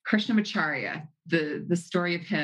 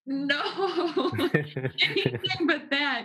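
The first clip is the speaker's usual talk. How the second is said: high, drawn-out mock-horrified plea; hammed-up dismayed disciple, half laughing; dramatic protest; 9 semitones higher, slower than usual. Disciple told by his master to marry, dramatic mock protest. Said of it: "I'm sure it was not that dramatic".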